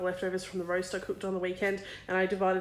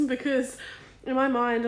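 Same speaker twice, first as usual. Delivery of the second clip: usual speaking voice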